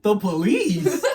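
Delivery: put-on stunned little kid who can't believe it; shocked disbelief. A seven-year-old hearing about the police, laughing in disbelief. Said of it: "I'm like".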